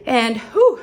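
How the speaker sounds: breathless puff after rushing around; out of breath. Real, not acted. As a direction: blow out a tired whew. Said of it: "I'm out of breath".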